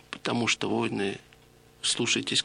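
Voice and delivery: ordinary talk, nothing marked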